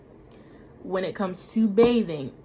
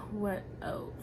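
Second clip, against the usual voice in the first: whispered aside; 8 dB quieter than usual. Real, not acted. Thinking aloud to herself.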